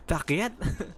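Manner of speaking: badly faked French baguette seller; mock French accent. Shown as a demonstration. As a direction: put on a joking French accent, rising at the end. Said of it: "you're trying to sell a baguette".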